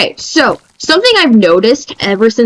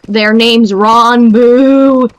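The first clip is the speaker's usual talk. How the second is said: slowly spelling out "Ranboo"; firm slow correction; correcting dad's pronunciation; much slower than usual. Retold or imitated. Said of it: "And I'm like".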